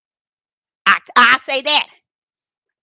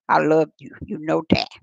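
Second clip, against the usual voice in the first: tender prayer; 12 semitones lower, 8 dB quieter than usual. Real, not acted. Tender aside to God.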